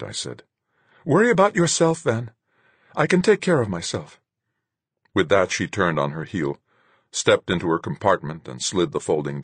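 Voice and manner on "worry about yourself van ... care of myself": high, loud brush-off; sharp retort. A narrator's reading. Marguerite dismissing Van before storming off, high and loud. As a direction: throw it back at him.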